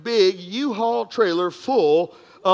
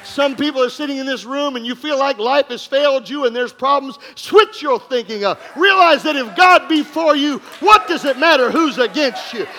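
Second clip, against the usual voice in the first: loud, higher climactic preaching; preaching at full force; 4 semitones higher, 6 dB louder than usual. Acted. Pastor challenging the congregation, loud preaching. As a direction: rouse the room.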